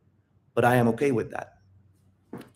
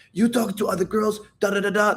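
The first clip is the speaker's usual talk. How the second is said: mock accusation; 8 semitones higher than usual. Shown as a demonstration. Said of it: "she's not saying".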